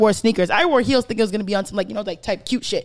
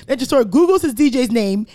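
normal talking voice

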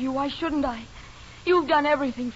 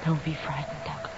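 a close secret whisper to a lover; seductive whisper; 6 semitones lower, 11 dB quieter than usual. Acted. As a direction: breathe it, barely voiced, luring him in. Evelyn's whispered seduction in the dark.

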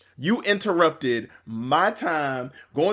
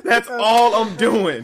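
slower, louder, laughing; slow laughing emphasis; 7 semitones higher, 5 dB louder, slower than usual. Real, not acted. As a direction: stretch it out while laughing.

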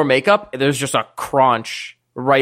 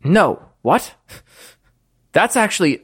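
normal talking voice

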